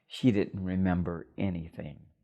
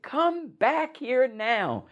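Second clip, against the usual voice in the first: high, slow demand; mother yelling; much slower than usual. Retold or imitated. Drunk mother shouts as the elevator doors close, high and slow. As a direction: stretch out a commanding call. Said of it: "And she said".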